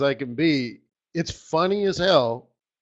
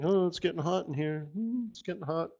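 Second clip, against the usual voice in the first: mock frog voice; 10 dB quieter than usual. Trader mocks complacent frog in warming water, soft mock voice. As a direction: play the oblivious frog getting warm, quietly. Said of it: "they're just sitting there like".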